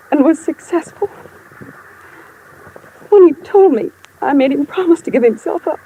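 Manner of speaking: the feeling bursts through as she remembers; voice breaking. Acted. Widow telling the investigator her confession, voice cracking.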